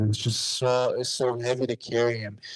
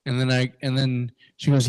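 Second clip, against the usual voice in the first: usual speaking voice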